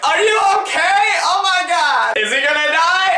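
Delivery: high, frantic imitation of friends; high mock panic of the crowd round him; mocking panicked friends. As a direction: shriek it like hysterical friends. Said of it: "just standing around me like".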